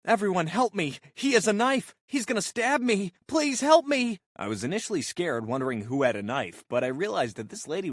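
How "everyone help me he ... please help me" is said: high, loud, panicked cries for help; frantic screaming. Retold or imitated. Karen begs the crowd for help, frantic high shrieking. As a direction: wail to the whole room that he has a knife.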